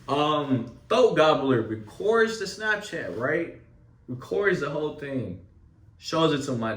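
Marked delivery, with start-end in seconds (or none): none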